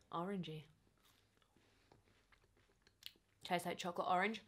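talking while chewing; speaking with food in mouth; mouth full, chewing. Real, not acted. Seen 3: Bites bar at 0–1.2, then chews visibly while saying words.

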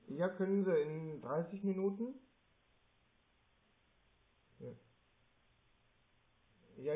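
talking softly into the other phone. Real, not acted. Aside call on the second phone. (leise nebenbei ins andere Telefon)

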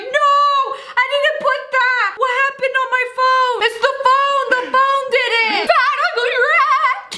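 high, frantic mom voice; panicked mom. Retold or imitated. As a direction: blame the phone in a panic. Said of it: "my mom would call me and be like".

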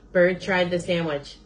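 plain reading voice

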